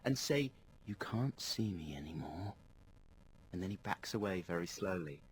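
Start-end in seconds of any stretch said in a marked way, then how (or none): 0.9-2.5 breathy low whisper, slowed down; hypnotic whisper. Retold or imitated. Darren leaning in, hypnotic.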